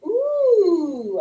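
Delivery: put-on "Ooh" with mouth in an O like a baby; ooh sound. Shown as a demonstration. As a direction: model the baby's ooh. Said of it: "it's like".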